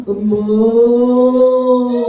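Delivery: long, drawn-out low sung moo; cow moo. Retold or imitated. Teacher imitating the cattle crying in the palace, a slow low moo. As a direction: stretch it into a slow lowing cow. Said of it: "막 이래".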